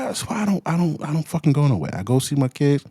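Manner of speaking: ordinary delivery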